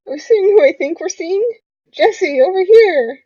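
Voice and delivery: high, loud delighted hail; excited calling out. Acted. Spotting an old friend, high excited shout. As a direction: call eagerly to a distant friend.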